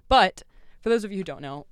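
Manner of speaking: usual speaking voice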